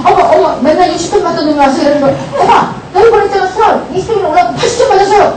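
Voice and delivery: put-on laughing excited kid; excited child. Shown as a demonstration. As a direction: show off a good test score with glee. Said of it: "이렇게 신나게 얘기하는데".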